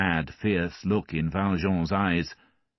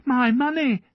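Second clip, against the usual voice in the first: put-on high, loud child's cry; a boy crying out for his stolen coin; 6 dB louder than usual. A narrator's reading. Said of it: "the boy cried".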